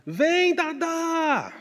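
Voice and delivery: very high, drawn-out call across the yard; mother hollering from the back door; mother's yell. Retold or imitated. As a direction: stretch out Vem, Dadá like a mum hollering. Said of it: "gritava assim".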